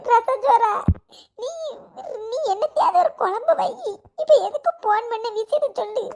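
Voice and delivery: sobbing and laughing at once, pitch pushed up; laughing through tears. Acted. Woman on the phone, crying and laughing as she denies crying. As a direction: insists she isn't crying while the sobs break through.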